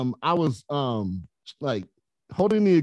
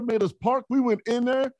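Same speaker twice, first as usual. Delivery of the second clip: ordinary delivery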